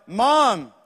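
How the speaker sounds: drawn-out loud call for Mom; boy yelling out. Acted. Small boy calls to his mom from bed, yelling out. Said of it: "the boy yells out".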